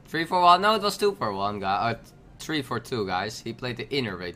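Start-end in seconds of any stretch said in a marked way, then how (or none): none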